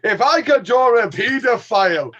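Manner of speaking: high, loud, slow mock Scottish mic test; hammed-up Scottish voice booming into the mic; imitating Rob's Scottish accent. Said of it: "Rob takes the mic".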